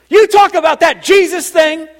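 loud, high-pitched South Side gal; Teresa impression. Parishioner telling pastor about the message, loud high impression. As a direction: belt it out as a brash Italian churchgoer. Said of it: "that's exactly how she talks".

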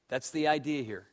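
ordinary talk, nothing marked

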